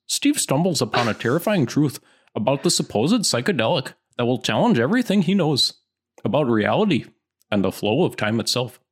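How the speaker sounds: sing-song through it in a put-on Yooper accent; Youper accent. Co-host reading a long film blurb, Yooper accent. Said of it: "what's, like, a good Youper?".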